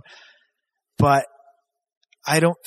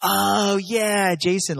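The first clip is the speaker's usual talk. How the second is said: niece's realization; 7 semitones higher, much slower than usual. Retold or imitated. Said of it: "She goes".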